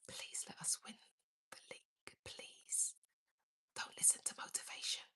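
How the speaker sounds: pleading little whisper. Pretend. Begging her viewers for the league, hushed whisper. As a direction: breathe it like a secret prayer. Mock secret prayer to the camera.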